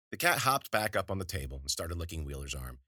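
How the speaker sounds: ordinary delivery, nothing marked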